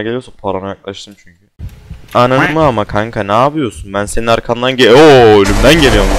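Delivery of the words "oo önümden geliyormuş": very loud shocked cry; startled cry. Real, not acted. Gamer finding the monster ahead, loud startled cry. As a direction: gasp it out as it jumps you.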